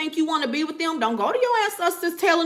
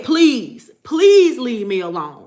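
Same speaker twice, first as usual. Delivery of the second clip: loud pleading; 8 dB louder, slower than usual. Retold or imitated. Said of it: "I done said".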